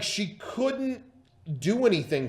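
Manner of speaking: ordinary talk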